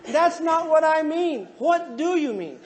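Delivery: high, slow, stretched complaint; mock exasperation. Couple misunderstanding each other over intimacy, high exasperated retort.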